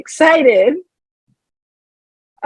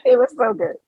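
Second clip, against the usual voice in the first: high, gloating squeak; high-pitched gloat; squeaky gloating; faster than usual. Real, not acted. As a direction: crow over the joke. Self-praise after joke.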